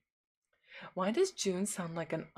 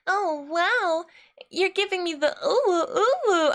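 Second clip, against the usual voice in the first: put-on high, louder cooing anime girl; cartoon anime voice; 10 semitones higher, 4 dB louder, slower than usual. Shown as a demonstration. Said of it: "I feel like a cartoon character".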